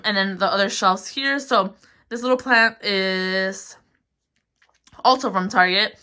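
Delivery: ordinary talk, nothing marked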